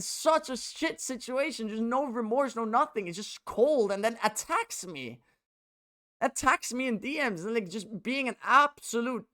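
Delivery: high, strained and slowed, close to breaking; slow, high, aching disbelief; real hurt and upset. Real, not acted. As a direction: pour out the betrayal, voice climbing as it hurts. Venting about a friend's betrayal.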